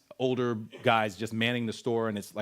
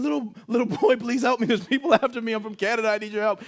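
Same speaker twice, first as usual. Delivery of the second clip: high, loud desperate begging; terrified pleading; 11 semitones higher, 5 dB louder, faster than usual. Retold or imitated. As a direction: beg the little boy for help in terror. Begging a small boy.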